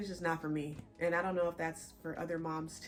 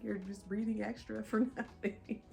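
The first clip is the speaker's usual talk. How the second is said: laughing while talking; 3 semitones higher, 5 dB louder than usual. Real, not acted. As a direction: laugh breaking through the words. Laughs while explaining her breathlessness.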